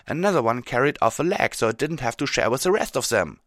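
loud, high, insistent account; impassioned character voice; heated Rainbow Dash. Acted.

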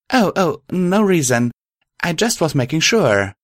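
high, hasty-to-excuse character voice; flustered brush-off. Acted. Caught out, brushing off question.

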